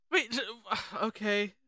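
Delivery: ordinary delivery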